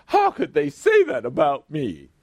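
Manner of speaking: high, indignant mock whine; haughty mock quote. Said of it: "we get all haughty".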